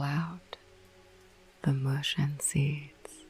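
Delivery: slow, spooky whispered verse reading; hushed, eerie poetry reading; whispered poem recital. Acted. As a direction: savour each line like a ghost story.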